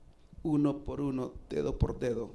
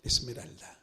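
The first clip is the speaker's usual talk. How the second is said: whispered name; 3 semitones lower, 3 dB quieter than usual. Acted. Remembers the girl he baptized.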